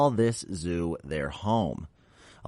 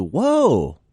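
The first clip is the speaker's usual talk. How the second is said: mock surprise; 7 semitones higher, 6 dB louder than usual. Reacting to old date.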